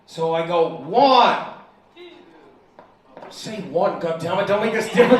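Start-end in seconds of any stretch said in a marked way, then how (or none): 0.7-1.4 teacher calling out. Retold or imitated. Teacher drilling kids.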